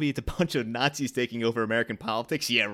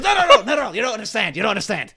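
loud, high, frantic mad scientist; Doc Brown impression; 8 semitones higher, 9 dB louder than usual. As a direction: warn him like a panicked Doc Brown. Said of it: "another Christopher Lloyd".

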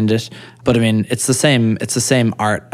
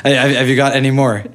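laughing; 4 semitones higher, 3 dB louder than usual. Real, not acted. Teasing through laughter.

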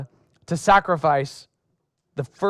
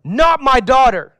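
anguished cry; 6 semitones higher, 6 dB louder than usual. A narrator's reading. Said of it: "he tore his clothes and said".

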